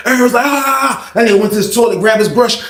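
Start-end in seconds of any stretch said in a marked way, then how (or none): 0.5-1.1 high drawn-out "aah"; imitated defiant holler; mock yell. Inmate answering guards, high mock yell.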